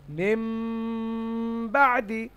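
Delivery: "মিম বাদি" with a long hummed m; "মিম বাদি", m held for one long beat; iqlab with ghunnah. Shown as a demonstration. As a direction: prolong the m of "মিম" nasally before "বাদি". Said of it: "এক আলিফ গুন্না সহ একলাব করে".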